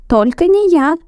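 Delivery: high squeaky shout; first mouse refusing loudly. A narrator's reading. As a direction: cry out not me. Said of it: "закричал другой".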